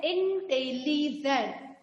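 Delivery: Intelligent slowly broken into four syllables. Shown as a demonstration. Teacher giving the class an example, intelligent slowly syllable by syllable. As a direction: sound out intelligent part by part. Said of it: "तोड़ तोड़ के different part में divide करके".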